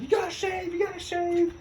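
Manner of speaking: high-pitched mock badgering; mock hazer. Hazers smearing shaving cream on recruits, high mock badgering. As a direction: chant it high, pestering the recruit. Hazers nagging during retold initiation.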